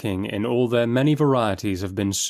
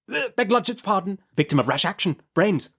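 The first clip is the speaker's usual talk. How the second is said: high, quick lawyer's interruption; hasty correction; 10 semitones higher than usual. A narrator's reading. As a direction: cut in too soon. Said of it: "Mister Tangle".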